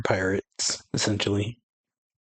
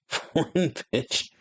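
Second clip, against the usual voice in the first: sneering laugh; 7 semitones higher, slower than usual. Acted. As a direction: spit it out through a slow mocking laugh. Villain sneers at the foreign woman.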